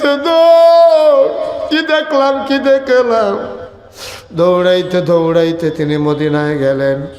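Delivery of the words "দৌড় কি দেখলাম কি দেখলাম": high, tearful preaching cry; anguished scream. Acted. Preacher voicing Omar's shock at the hunger, anguished screaming. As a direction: scream it high, holding the long word. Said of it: "Omar একটা চিল্লান দিয়ে দিছে".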